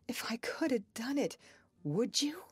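eager question, pitched high; crying out. A narrator's reading. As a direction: plead with sudden hope, voice lifted. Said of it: "he cried".

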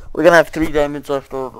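loud, fast, muffled behind a cupped hand. Real, not acted. Gamer explaining a diamond count, muffled behind cupped hand. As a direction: talk fast through a hand over the mouth. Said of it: "I was just cuffing my mouth right there".

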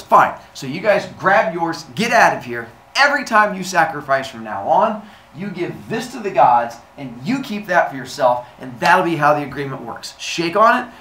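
loud, forceful decree; Zeus. Acted. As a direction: lay down the law. Zeus dictates the agreement.

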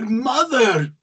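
protesting son. Acted. Constantine cries out to his mother as she pulls his ear, high and loud. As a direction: yelp as you are dragged out.

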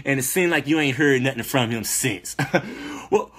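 normal talking voice

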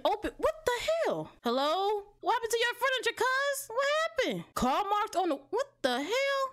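very high panicked voice; panicking at the empty room; freaked out. Real, not acted. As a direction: near tears, pitch way up. Furniture gone, frightened.

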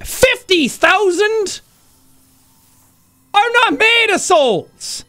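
high incredulous squeak; outraged high pitch. Real, not acted. Haggling with NPC over price.

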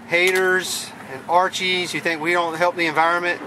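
ordinary talk, nothing marked

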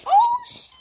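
sudden high, loud oh shit; real startled yelp. Real, not acted. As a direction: jump at a surprise. Startled while setting table.

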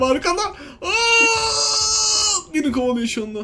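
high scream and nervous laugh; being chased. Real, not acted. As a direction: shriek while something chases you. (kovalanırken çığlık atarak)

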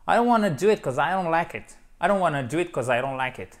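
rattled off quickly, contractions slurred; fast casual speech. Shown as a demonstration. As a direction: fire it off like native chat. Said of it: "Ça va dix fois plus vite".

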